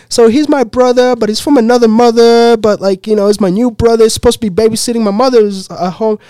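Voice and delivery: put-on high, loud little-kid voice; little girl explaining. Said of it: "this little girl starts explaining".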